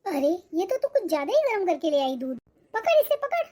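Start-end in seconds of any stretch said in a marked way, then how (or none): 2.7-3.5 much higher, yelping in pain. Acted. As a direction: yelp as the hot cup burns her hand. Hot milk burns her hand.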